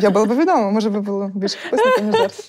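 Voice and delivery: laughing. Real, not acted. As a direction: giggle through the punchline. Joking about more subscribers. (крізь сміх, на високих нотах)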